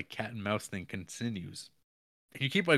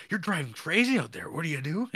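mock angry stranger; 9 semitones higher, 7 dB louder than usual. Man scolds at car window.

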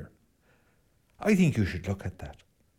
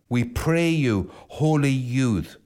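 pleading call; 2 semitones higher, slower than usual. Retold or imitated. Priest voicing the Irish calling Patrick back, slow plea. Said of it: "what were they saying to him".

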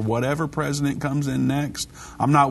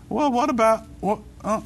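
high-pitched, flustered self-mockery; mock defensive excuse; 10 semitones higher than usual. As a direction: play caught out, stammering in a high voice. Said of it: "I get called out on it, I go".

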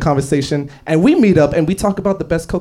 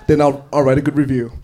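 ordinary talk

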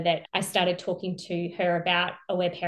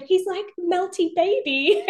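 slow, high tender wonder; delighted; 10 semitones higher, 4 dB louder than usual. Retold or imitated. Mum describing her relaxed baby, tender and delighted. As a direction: coo it fondly.

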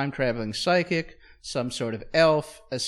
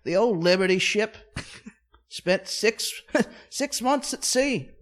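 higher comic British voice, chuckling through; hammed-up English yarn-spinner; English immigrant storyteller; 6 semitones higher, 3 dB louder than usual. English newcomer recounting a fantastical voyage, mock British accent. Acts English character's journey.

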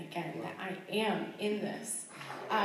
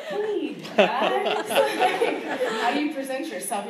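much higher and louder, laughing through it; awkward laughing greeting; 5 semitones higher, 9 dB louder, slower than usual. Retold or imitated. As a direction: play the nervous first-date hello with giggles. Awkward first Skype call retold.

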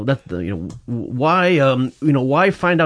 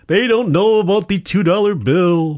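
mock announcer voice; 7 semitones higher, 5 dB louder, slower than usual. Mock op-ed hand wringing.

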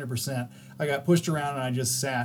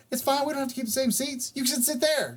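lightly voiced girl; 10 semitones higher than usual. Other girl voiced in story.

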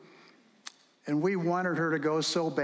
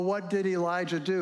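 ordinary talk, nothing marked

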